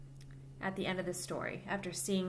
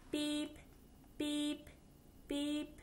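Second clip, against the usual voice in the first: tractor beep; 7 semitones higher, 5 dB quieter, much slower than usual. Acted. Tractor backing up in story.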